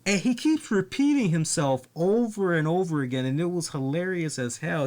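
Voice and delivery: ordinary delivery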